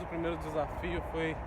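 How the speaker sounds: ordinary talk, nothing marked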